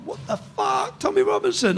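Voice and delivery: high-pitched, softened mock howl. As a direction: imitate a howling crowd at reduced volume. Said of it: "like they're howling".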